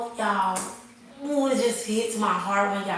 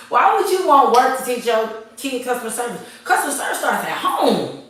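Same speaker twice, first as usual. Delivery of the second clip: preaching at full force, higher and much louder; impassioned rant; 8 semitones higher, 7 dB louder than usual. Real, not acted. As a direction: pound every line like a fired-up sermon. Heated rant to her viewers.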